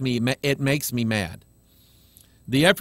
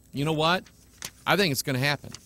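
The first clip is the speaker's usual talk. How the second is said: usual speaking voice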